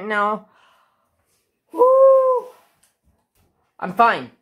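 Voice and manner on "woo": loud, high whoop of joy; a real burst of excitement; excited whoop. Real, not acted. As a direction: let out a thrilled woo. Thrilled while unboxing.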